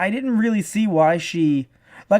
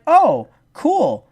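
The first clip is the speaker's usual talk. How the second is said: mock delight; 9 semitones higher, 5 dB louder than usual. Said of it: "is like".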